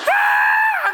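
long, very high "aah"; acted falling scream; terrified scream. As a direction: scream as you plummet. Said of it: "screaming at the top of my lungs".

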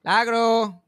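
loud call of the name Lagro. Retold or imitated. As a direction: call out to her through the door.

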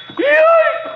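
sharp high yelp of pain. Acted. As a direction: yelp as something hurts. Stubs toe in dark.

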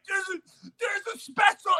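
put-on a kid insisting a plane is coming; excited kid shout. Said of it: "He's like".

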